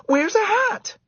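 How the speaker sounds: high loud shout; shouting girl. A narrator's reading. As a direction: shout it urgently. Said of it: "someone shouted".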